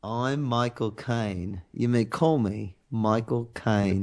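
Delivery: slow, low mock British accent; low, deliberate imitation of a British film star; Michael Caine. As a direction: draw it out in a hammed-up British actor impression. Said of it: "I'm gonna say Michael Caine".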